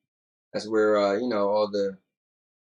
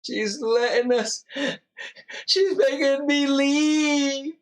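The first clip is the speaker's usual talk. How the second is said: put-on much higher, slow, whiny baby voice; mock whiny; 3 dB louder, much slower than usual. Host whining to viewers that his wife makes him leave, high and slow. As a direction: whine like a pouting child, drawn out.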